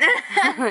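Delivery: sudden high burst of laughter. Real, not acted. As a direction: let out a loud laugh. Laughs at friend's pimple.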